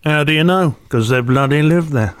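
lightly voiced comic delivering a gag; Terry Alderton. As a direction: tell the joke in the comedian's voice, with a laugh at the end. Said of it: "That's a very good impression".

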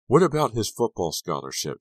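high, loud frantic accusation; yelling. A narrator's reading. Girlfriend berating Kerry over the injury, yelling. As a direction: yell it in outrage. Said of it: "Sharon yelled".

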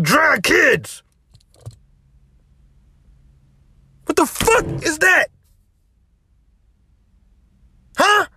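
high, loud outrage; flaring up again in disbelief; incredulous angry shout. Real, not acted. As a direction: explode at the absurdity. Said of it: "going off on these wild tangents".

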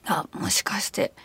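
息だけでそっと囁く; 内緒話のようにゆっくり囁く; ひそひそ声. Retold or imitated. 探るように小声で問う. (浮気を疑う女の子の声真似、囁き声で)

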